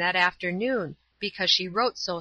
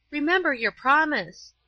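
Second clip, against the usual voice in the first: higher-pitched urgent reminder; pleading cry; 7 semitones higher, 3 dB louder than usual. A narrator's reading. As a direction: appeal to a cousin, pitched up. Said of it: "cried Paula".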